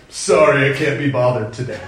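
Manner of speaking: mock snooty brush-off; snooty dismissal. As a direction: turn your nose up, dismissive. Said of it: "stick up my nose and be like".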